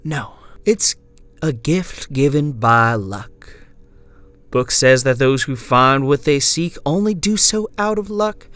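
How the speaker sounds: earnest cult follower reciting doctrine; zealous believer. Acted. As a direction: preach the creed in a lifted voice. Selena preaches her belief to narrator.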